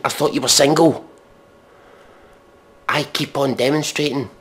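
ordinary talk, nothing marked

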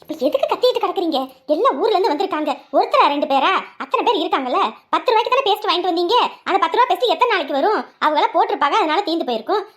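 loud, exasperated comeback with a laugh; loud retort. Acted. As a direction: fire it back at him, loud. Wife shouting back at husband.

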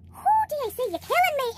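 high thrilled call to the company; delighted whoo. Real, not acted. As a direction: cheer it out high and happy. Overjoyed at four bags.

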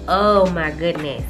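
ordinary delivery

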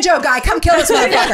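high, loud comic summons; mock calling out. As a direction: call a stranger over in mock outrage. Imagined calling out to banjo player.

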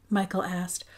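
plain reading voice

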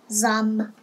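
ordinary delivery

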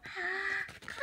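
a soft, high pretend gasp of wonder; mock gasp. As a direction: gasp as if amazed by the picture. Reacting to picture in book.

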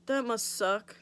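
imitated offhand sympathy; nonchalant. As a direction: act lightly unbothered. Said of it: "it's weird how I'm nonchalant".

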